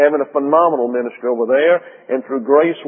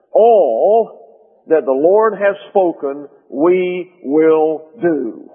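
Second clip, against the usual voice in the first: people's solemn answer; 3 semitones higher, much slower than usual. Acted. Israel answers Moses together, in a slow, solemn declamation. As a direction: pronounce it like a weighty collective vow. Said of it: "all the people answered together and said".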